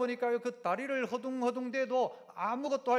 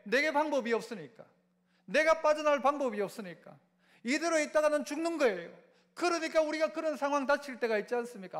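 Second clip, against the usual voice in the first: ordinary delivery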